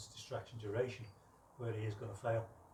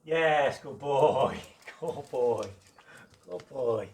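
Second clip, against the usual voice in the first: excited praise; 3 semitones higher, 14 dB louder, much slower than usual. Real, not acted. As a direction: reward him with big loud joy. Gushing praise after success.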